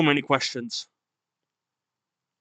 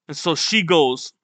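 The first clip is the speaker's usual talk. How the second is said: usual speaking voice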